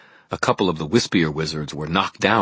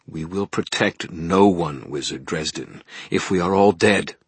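ordinary delivery

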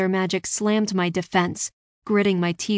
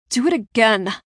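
louder, choked-out demand; 3 semitones higher, 5 dB louder than usual. A narrator's reading. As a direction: force it out through a mouthful of dust. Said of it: "I choked".

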